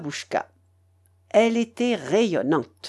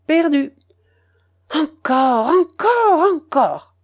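urgent grandmother; 7 semitones higher, 4 dB louder, slower than usual. A narrator's reading. Grandmother urging more bets.

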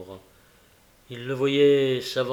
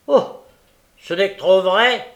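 loud, raised character voice; grave traveller; 6 semitones higher, 9 dB louder than usual. A narrator's reading. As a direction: confirm the dreadful news emphatically. Traveller confirms the news.

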